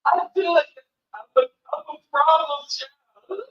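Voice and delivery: high, loud, tearful wail imitating a breakdown; acted-out sobbing admission, easing slightly at the end; anguished outburst. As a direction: cry out the confession, starting at a shout. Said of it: "it came out".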